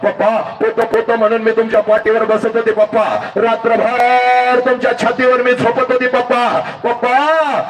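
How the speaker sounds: rising high, tearful plea to papa; a daughter's aching call, pitched high; pleading daughter. Acted.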